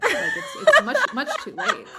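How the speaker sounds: high laughing voice through the protest; half-laughing denial of the good news. Retold or imitated. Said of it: "I sort of argued with her".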